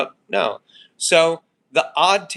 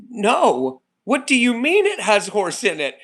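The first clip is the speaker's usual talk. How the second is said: higher, louder cry of mock horror; shocked disbelief; 8 semitones higher, 4 dB louder than usual. Deli worker imitating a customer hearing about horse salami, shocked disbelief. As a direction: play the outraged old customer who just can't believe it.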